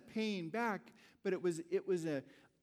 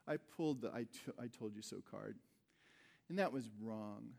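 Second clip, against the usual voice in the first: soft apology; 7 semitones lower, 6 dB quieter than usual. Retold or imitated. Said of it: "talk to Catherine that night and say".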